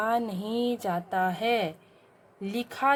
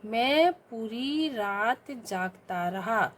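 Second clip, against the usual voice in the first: very slow, word by word, for pupils to write down; slow dictation; much slower than usual. Shown as a demonstration. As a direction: read it out slowly to the class. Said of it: "क्या होगा शुद्ध रूप". (धीमे-धीमे बोलकर लिखवाना)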